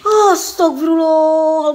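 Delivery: loud, long drawn-out istighfar; alarmed invocation. Real, not acted. Ghost hunter reacting to a scare, loud invocation. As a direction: cry it out slowly and loudly.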